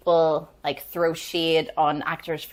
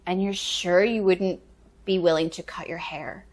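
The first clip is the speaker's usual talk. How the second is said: retold second request; 4 dB quieter than usual. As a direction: draw the question out, checking once more. Director asks again before she leaves.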